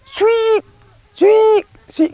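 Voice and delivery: very high, short piercing squeaks; tapir's small squeak. Retold or imitated. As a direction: squeak like an animal that can't holler. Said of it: "He can't holler".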